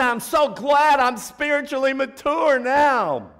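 high, loud, slow mock-pious voice; mock a self-satisfied churchgoer; smug believer. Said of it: "You say".

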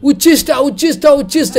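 high, loud outcry of alarm; shouting. Retold or imitated. Sannyasi finds his offering spoiled, shouting. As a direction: cry out in high, rapid repeats. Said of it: "is shouting".

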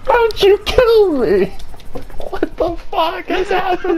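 high, loud bewildered yell; high-pitched exasperated shout; outraged shouting. Real, not acted. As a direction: shriek in disbelief at being killed. Killed again, yelling at teammate.

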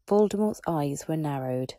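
ordinary delivery

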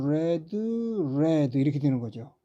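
"read" said red, short e; "read" as red in past and participle. Shown as a demonstration. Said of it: "동사 원형부터 과거 분사까지 읽어보면".

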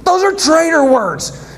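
high, outraged disbelief; shocked listener. Acted. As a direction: squeal it like you can't believe your ears. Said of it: "And he's like".

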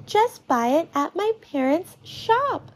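put-on high-pitched girl's voice; child telling off a friend, pitched high; Beth, chiming in. A narrator's reading. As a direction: chime in high, as a shopkeeper's daughter. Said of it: "Beth chimes in".

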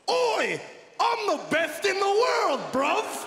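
put-on caricatured Cockney accent; mock Cockney. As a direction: ham up a Cockney boast. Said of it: "from a Cockney cockhead".

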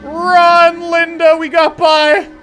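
high, loud excited cry; excited shout. Real, not acted. Player urging Linda to run, excited shout. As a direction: yell it as you escape.

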